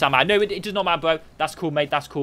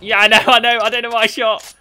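excited; 8 semitones higher, 7 dB louder than usual. Real, not acted. Surprised mid-game reaction.

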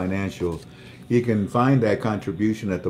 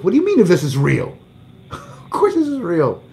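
high, fast, disbelieving with a laugh; what do you mean, half laughing, pitched up; incredulous inner voice; 9 semitones higher, 6 dB louder than usual. Retold or imitated. Retelling his own thoughts, high incredulous half laugh. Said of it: "I thought to myself".

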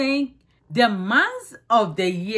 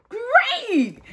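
bright, much higher "Great!"; excited praise; 12 semitones higher than usual. Real, not acted. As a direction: cheer them on. Teacher praising children.

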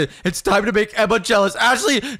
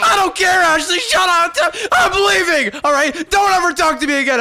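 high-pitched angry yelling; furious; 8 semitones higher than usual. Acted. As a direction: shout it while storming out of a quarrel. Storms out of jealous quarrel.

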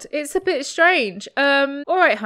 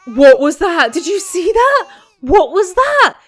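startled shout; 5 semitones higher, 9 dB louder than usual. Real, not acted. Startled by sim's sudden noise.

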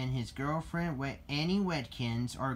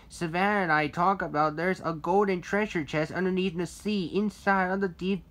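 excited; 5 semitones higher, 5 dB louder than usual. A narrator's reading. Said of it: "said Ricky excitedly".

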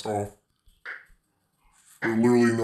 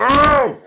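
sudden yelp of pain; sharp ow; 11 semitones higher, 7 dB louder than usual. Real, not acted. Gamer yelping in pain at a sudden hit. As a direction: yelp as if stung.

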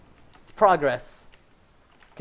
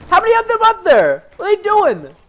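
high, loud incredulous yell; alarmed shout; 12 semitones higher, 6 dB louder than usual. Real, not acted. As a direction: shout in disbelief at the game. Alarmed by enemies upstairs.

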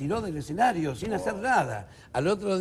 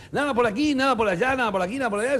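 showman magician; 6 semitones higher, 5 dB louder, faster than usual. Acted. Joke's magician performing.

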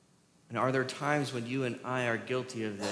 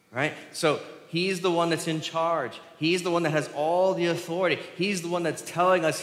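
usual speaking voice

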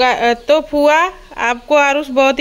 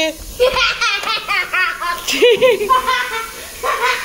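long, high peals of laughter; delighted laughter; 9 semitones higher than usual. Real, not acted. Mother finding her hiding little boy, delighted laughter.

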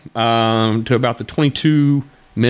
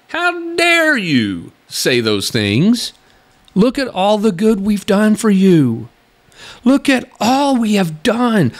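high, slow, affronted mock outrage; mock abuser indignation; 8 semitones higher, slower than usual. A commentator mocking an abusive leader, slow and high. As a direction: play the offended abuser, drawn out. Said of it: "something many abusers will do".

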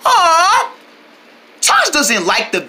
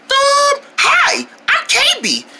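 ordinary talk

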